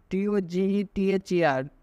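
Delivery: ordinary delivery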